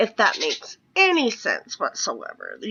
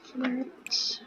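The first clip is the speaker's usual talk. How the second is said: whisper; 10 dB quieter than usual. Real, not acted. Quiet aside while playing.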